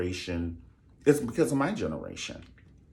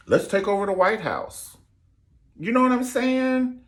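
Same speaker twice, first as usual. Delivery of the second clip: mock rioter boast; 13 semitones higher, 7 dB louder than usual.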